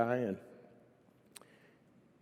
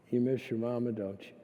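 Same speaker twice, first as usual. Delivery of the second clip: soft, tender question, trailing quieter; gentle father; 3 semitones lower, 4 dB quieter than usual. Retold or imitated. Father comforting his five-year-old daughter, gently. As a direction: ask it gently and low-key.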